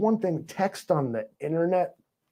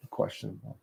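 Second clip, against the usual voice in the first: whispered aside; 13 dB quieter than usual. Shown as a demonstration. Teasing his fans with a whispered "questionable" echo. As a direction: whisper "questionable" under the breath. Repeats the word, second hushed.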